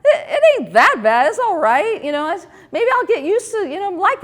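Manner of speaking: mock pained, laughing reassurance to herself; reluctant self-persuasion. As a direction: talk yourself into liking his hand, wincing through a laugh. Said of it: "and I'd just be like".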